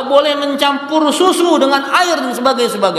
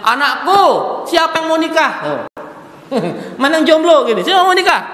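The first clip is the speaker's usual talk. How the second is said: eager call; 6 semitones higher than usual. Acted. Umar eagerly asks his sons.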